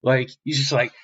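plain reading voice

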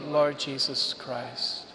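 ordinary talk

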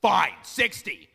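loud, slow, resigned blurt; exasperated guess. Retold or imitated. Husband caving in the T-shirt guessing game, loud. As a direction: give in with a loud sigh of a guess.

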